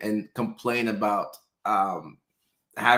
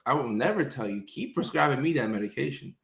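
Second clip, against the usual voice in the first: ordinary delivery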